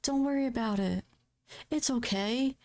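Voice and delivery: a lightly voiced soothing nurse; coaxing nurse. Staff urging patient to take her time, high sugary coaxing. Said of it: "I get nervous when people are like".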